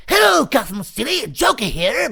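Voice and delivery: a tried-out cartoon Joker announcing himself to the city. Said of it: "I tried doing some different voices".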